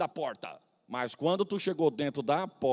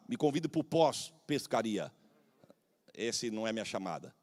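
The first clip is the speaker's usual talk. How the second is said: ordinary talk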